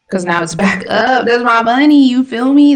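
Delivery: real frustrated yell about his money; frustrated shout. Real, not acted. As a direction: yell it at the chart, exasperated. Trade moving away from him.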